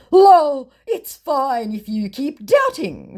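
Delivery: hammed-up shrugging scammer; mock scammer voice. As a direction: toss it off like the scammer. Voicing scammer's dismissal.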